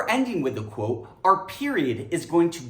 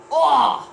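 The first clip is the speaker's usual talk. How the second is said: loud, high exasperated groan; hammed-up disgusted ugh; 9 semitones higher, 8 dB louder than usual. Disgusted moviegoer grunt.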